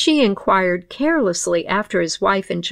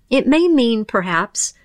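ordinary delivery, nothing marked

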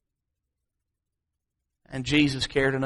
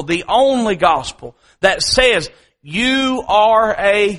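raised, forceful preacher's delivery; loud, high-pitched pulpit proclamation; emphatic preaching; 3 semitones higher, 6 dB louder than usual. Real, not acted.

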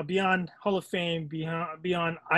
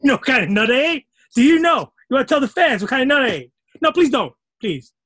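higher, louder, fast and flustered; flustered pleading; 6 semitones higher, 6 dB louder, faster than usual. Real, not acted. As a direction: rush to stop him from telling. Begs him not to tell.

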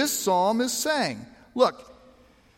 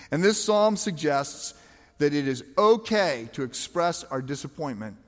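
ordinary talk, nothing marked

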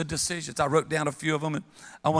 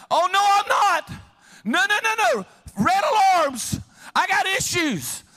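much higher and louder, no no no; alarmed protest; 5 dB louder than usual. Real, not acted. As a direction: sound the alarm, half joking. Pastor's alarmed reaction.

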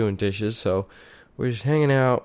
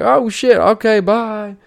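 mock startled stranger; 10 semitones higher, 3 dB louder, slower than usual. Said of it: "He's like".

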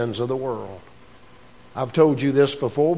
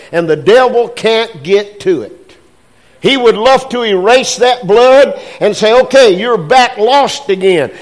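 loud, raised preaching voice building up; full-force preaching; 6 semitones higher, 7 dB louder than usual. Acted. Pastor preaching on the blood of Jesus, loud and raised. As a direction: lift it and drive it home like a revival.

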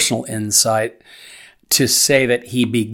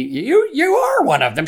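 bystanders' voice pitched much higher, accusing. A narrator's reading. Bystanders accusing Peter of being a Galilean, high and insistent. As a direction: point the finger in a high, insistent voice.

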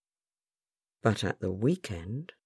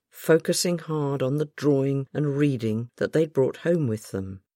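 ordinary delivery

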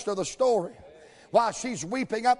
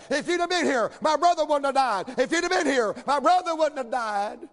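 higher, insistent in-your-face accusation; confrontada, en su cara; 4 semitones higher than usual. Retold or imitated. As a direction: act out Martha confronting him head-on. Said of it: "she gets up in his face".

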